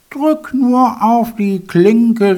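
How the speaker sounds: slow, high frail granny call; old grandmother voice. A narrator's reading. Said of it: "rief die Großmutter".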